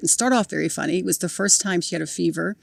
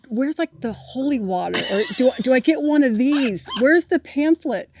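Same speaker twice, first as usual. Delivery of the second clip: mock bewilderment; 6 semitones higher, 5 dB louder than usual. Mocking the clergymen who left.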